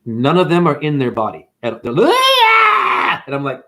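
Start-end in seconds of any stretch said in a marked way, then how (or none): 2.1-3.2 high, loud drawn-out shriek; hammed-up shrill yell; mock scream. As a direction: let out a wild imitated scream. Acts out people reacting on medication.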